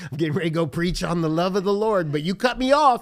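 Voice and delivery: loud, high, fast comic rant; hammed-up indignant outburst, rising to a shout; mock road rage. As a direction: yell it in playful exasperation at another driver. Acting out own road rage.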